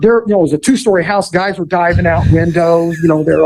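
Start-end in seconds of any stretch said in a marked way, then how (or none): none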